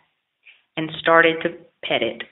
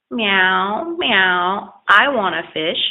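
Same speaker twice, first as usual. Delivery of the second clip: cat voice; 2 semitones higher, much slower than usual. Acted. Hungry cat begs for fish.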